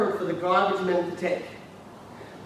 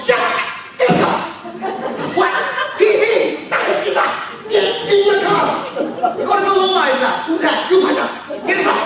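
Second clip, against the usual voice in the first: mad guy; 7 semitones higher, faster than usual. Acted. Recruiter ordering a friend into the car, mad passion. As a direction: bark commands like a madman. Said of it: "be like a mad guy going".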